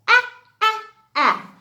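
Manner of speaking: "ah" said as short a like in apple, repeated; short a sound. Shown as a demonstration. Teacher sounding out the letter A to the class, crisp short ah. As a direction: sound out "ah" crisply, three times. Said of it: "A says".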